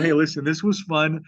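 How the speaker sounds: ordinary talk, nothing marked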